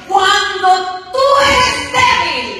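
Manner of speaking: loud, drawn-out sung proclamation, slow; preaching at full force. Acted. Preacher proclaiming God's word to the church, slow sung shout. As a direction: stretch the words like a hymn.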